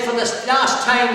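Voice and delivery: high, loud final warning; insistent call. Acted. Priest to people still kneeling outside, loudly insisting.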